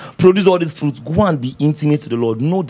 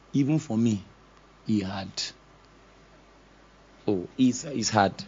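softer, confiding, drawn low; quiet confession; 9 semitones lower, 11 dB quieter than usual. Real, not acted. To the congregation, admitting it's hard, softly confessing. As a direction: let it out like a private admission.

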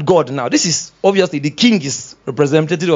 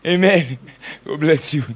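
ordinary talk

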